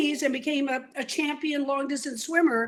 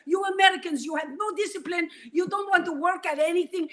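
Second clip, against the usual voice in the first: scolding Russian coach, a bit higher; Russian accent; 5 semitones higher than usual. Retold or imitated. Natasha scolds the kids at the bus stop, Russian accent. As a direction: lecture the lazy Americans in a Russian accent. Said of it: "new Russian tennis pro".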